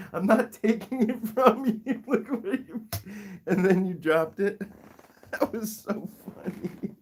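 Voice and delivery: high-pitched words through real laughter; helpless, squeaky amusement; laughing while talking. Real, not acted. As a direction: talk while cracking up. Cracking up at pet's antics.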